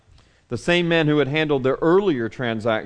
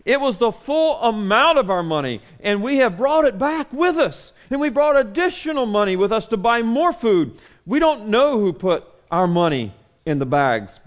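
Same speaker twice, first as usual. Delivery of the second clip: higher, panicked pleading voice; scared near-screaming; 7 semitones higher than usual. Acted. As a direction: blurt it out like terrified men. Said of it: "They're screaming practically".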